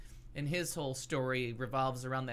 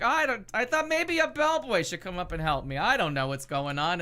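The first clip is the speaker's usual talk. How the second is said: loud, high New Yorker impression; New York accent; 5 semitones higher, 7 dB louder than usual. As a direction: play up a brash New Yorker. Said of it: "he's got the New York accent".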